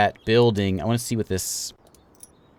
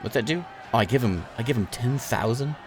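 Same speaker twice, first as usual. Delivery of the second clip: ordinary delivery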